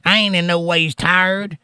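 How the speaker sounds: mock put-on Southern accent, high, slow and drawn out; fake Southern accent. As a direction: imitate a politician faking a Southern accent. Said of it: "that sounded fake to me".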